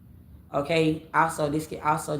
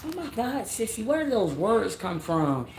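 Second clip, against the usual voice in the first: mock sassy shock; 4 semitones higher than usual. Reacting to his own big words, mock sassy shock. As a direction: play a scandalized friend who is mostly joking.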